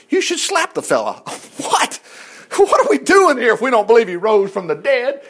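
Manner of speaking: usual speaking voice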